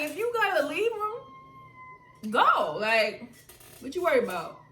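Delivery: high, loud, slowed imitation of grandmother; lightly voiced grandma, firm and dismissive; grandma's blunt advice. Grandma telling her granddaughter to leave him. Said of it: "She's like".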